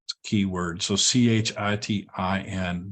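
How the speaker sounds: usual speaking voice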